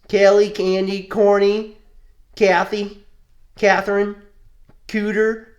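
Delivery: loud, high run of names; mock name list. Friend mocks corny kid names, loud and high. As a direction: call out corny names for laughs.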